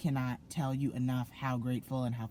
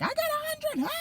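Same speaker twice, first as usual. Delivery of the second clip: very high, louder excited shriek; imitating a scream; 4 dB louder than usual. Classmates shrieking over their grade, very high cry. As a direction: shriek it like a classmate who aced it. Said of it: "just started screaming".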